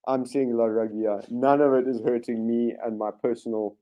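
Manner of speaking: ordinary talk, nothing marked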